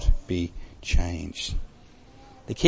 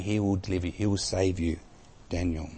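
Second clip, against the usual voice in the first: much softer, a hushed promise to Daniel; 5 semitones lower, 13 dB quieter than usual. Retold or imitated. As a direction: drop to a soft, intimate hush. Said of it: "Darius is saying".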